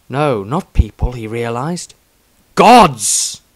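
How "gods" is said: awed shout. A narrator's reading. Sudden awed realization of gods.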